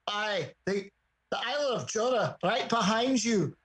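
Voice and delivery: put-on Scottish accent. Customer repeating an order to the barmaid, Scottish accent. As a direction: imitate a Scot's accent to be understood. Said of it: "She understood that accent".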